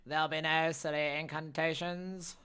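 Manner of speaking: hammed-up loud stern character; second character take. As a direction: forbid incantations in a bigger voice. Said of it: "Terrible, terrible, terrible, attempt there".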